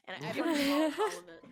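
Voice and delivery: loud, fast, triumphant brag. Real, not acted. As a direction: blurt it out proudly and fast. Triumphant punchline.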